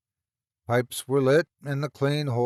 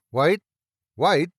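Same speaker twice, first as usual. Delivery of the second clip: calling out; 5 semitones higher, 6 dB louder than usual. Acted.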